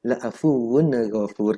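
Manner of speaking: "La'afuwun ghafur" with a stray ne after wun; dragged, added ne. Shown as a demonstration. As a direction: slowly slur "La'afuwun" into la'afuwu-ne before "ghafur". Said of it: "ada ne-nya".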